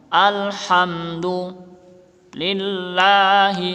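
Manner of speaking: Alhamdulillahi with the lam stretched; long lam. Shown as a demonstration. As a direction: sing out Alhamdulillahi, hold the lam. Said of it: "Ini lamnya panjang".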